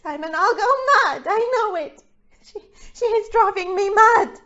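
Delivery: loud, fast, near tears; on the edge of hysteria; panicked. Acted. Wife cries to her husband that Jackie drives her mad, panicked.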